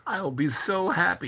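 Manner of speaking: high, mock-tearful voice; near tears. The lawyer on keeping Madame happy, imitated near tears. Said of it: "he almost starts crying".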